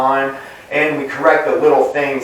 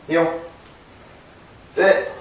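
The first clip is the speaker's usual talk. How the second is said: short commands; 5 semitones higher, 3 dB quieter than usual. Real, not acted. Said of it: "show you guys one more time".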